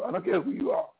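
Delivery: normal talking voice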